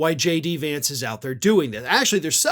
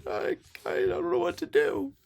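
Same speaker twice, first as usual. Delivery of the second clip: high, soft mock-panicked whimper; 10 semitones higher, 8 dB quieter than usual. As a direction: play a scared insider, hammed-up. Mocking panicked Harris insiders.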